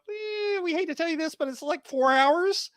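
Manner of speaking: high, hushed apologetic airline agent; apologetic airline clerk telling of a long delay; mock gate agent. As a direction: play the reluctant agent breaking bad news. Gate agent quoted, bad news.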